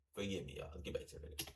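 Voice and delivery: dropped to a near whisper; muttered apology, then trailing into a whisper; sheepish aside. Real, not acted. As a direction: mutter an apology under your breath. Sheepish aside after a bad take.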